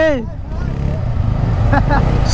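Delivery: high laugh fading off; light laugh. Real, not acted. Laughing while riding.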